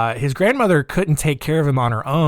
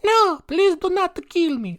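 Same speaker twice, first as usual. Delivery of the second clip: much higher, slow, mock-begging kid in an Italian accent; pleading Italian accent. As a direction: plead like a scared child with an Italian lilt. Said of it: "I don't know why they're Italian".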